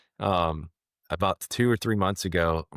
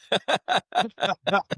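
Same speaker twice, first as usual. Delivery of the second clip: high-pitched burst of real laughter; laughing; 13 semitones higher than usual. Real, not acted. Storyteller cracking up at his own punchline, laughing. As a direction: crack up in a long high cackle.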